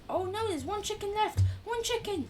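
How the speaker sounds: shocked that only one is left; alarmed. Real, not acted. Reacting to last chicken.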